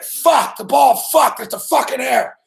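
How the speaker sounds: exploding over the bad play, slamming the desk; retold outburst. Host slamming the desk on air, yelling at the error. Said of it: "slammed the desk".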